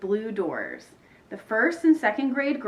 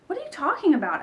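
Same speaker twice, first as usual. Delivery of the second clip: high-pitched, puzzled demand; baffled question; 8 semitones higher, faster than usual. A narrator's reading. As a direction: ask it as a bewildered child character. Emma baffled by Ivy's claim.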